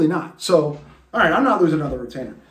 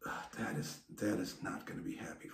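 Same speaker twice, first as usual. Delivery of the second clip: whispered worried mutter, much lower; 8 semitones lower, 19 dB quieter than usual. Retold or imitated. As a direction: mutter it under your breath like a worried mom. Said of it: "she even muttered".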